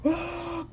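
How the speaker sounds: high mock shriek of panic; retold panicked shriek; imitated scream. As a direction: shriek it like a man swarmed by bugs. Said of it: "he was like".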